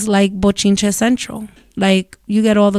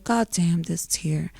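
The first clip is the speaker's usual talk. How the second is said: mock sad groan; 4 semitones lower, 10 dB quieter, slower than usual. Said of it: "little sad face".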